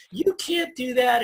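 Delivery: high, drawn-out motherly put-down; slow, high voice of self-doubt; nagging inner voice. Acted. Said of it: "mine sounds like my mother".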